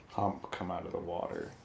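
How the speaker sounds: ordinary talk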